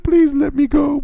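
high, slow, whiny plea; comic helpless whimper; mock pleading. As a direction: imitate begging to be released. Retold plea to leave class.

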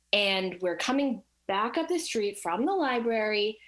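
ordinary talk, nothing marked

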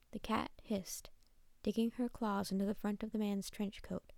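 usual speaking voice

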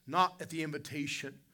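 loud, rising preacher's cry; building preaching. Acted. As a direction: build the sermon to a climax. Rising sermon climax.

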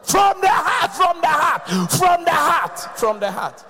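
high, loud preacher's shout; shouted refrain. Real, not acted. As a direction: cry it out from the heart.

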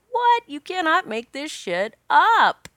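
high-pitched "what", then drawn-out incredulous words; amazed disbelief. Retold or imitated. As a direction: retell a stunned reaction, stretching every word. Retells own amazed reaction to news.

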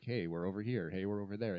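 ordinary delivery

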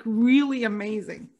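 ordinary delivery

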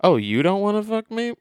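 breezy high shrug; mocking the creep switching to the friend, high and breezy; mock casual.

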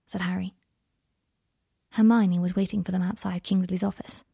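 plain reading voice

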